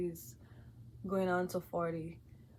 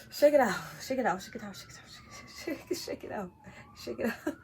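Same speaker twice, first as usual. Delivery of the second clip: panting, fading to a breathless whisper; hyperventilating; 3 semitones higher, faster than usual. Real, not acted. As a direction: breathe it out fast, then trail off. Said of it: "I'm having an anxiety attack".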